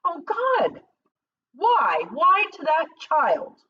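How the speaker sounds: high, loud howl to heaven; anguished cry. Acted. Doctor cries out to God over the blinded child. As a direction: rail at God like a mad prophet. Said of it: "cried out".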